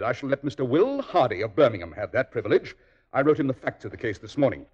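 ordinary talk, nothing marked

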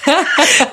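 a high, laughing mock shriek of fright; a playful retold yelp of shock; startled scream. Rachel wakes to stranger, screams.

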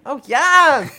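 loud high mock ecstasy; ecstatic moan. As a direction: cry out in fake pleasure. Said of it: "and I'll be like".

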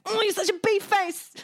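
high-pitched mock little-kid taunt; put-on high kid voice; child's insult. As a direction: imitate a cross small boy name-calling.

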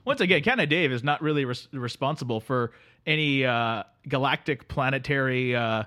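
sleepy Canadian drifter greeting his pal; Canadian accent. Acted. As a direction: play the neighbourly Canadian couch guest. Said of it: "Canada Dave".

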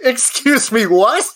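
high incredulous excuse me; double take. Real, not acted. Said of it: "I wasn't ready for that news".

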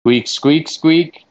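loud, rising squirrel squeaks; squirrel talk. Pretend. Interpreter asks squirrel about steps taken, squirrel talk. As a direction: squeak a pointed question in mock squirrel.

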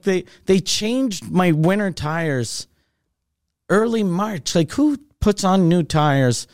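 ordinary talk, nothing marked

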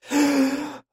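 long breathy exhale. Acted. Man after gulping wine, heavy sigh. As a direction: let out a long calming sigh. Drinks, then calms himself down.

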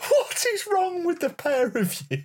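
high, helpless laughing protest; laughing disbelief. Real, not acted. Co-host reacting to the pair's accent attempt, laughing in disbelief. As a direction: squeal it through laughter.

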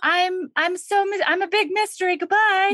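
high, hurried playful goddess; flighty, mock-mysterious goodbye; coy evasion. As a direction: brush them off in a high singsong. Acting goddess coyly dodging questions.

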